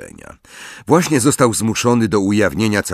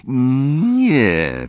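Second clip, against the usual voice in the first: understated, higher-pitched anger; through gritted teeth; 6 semitones higher than usual. A narrator's reading. Harry denies Snape's accusation, through gritted teeth. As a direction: squeeze out a reluctant denial through the teeth. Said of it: "wycedził Harry przez zęby".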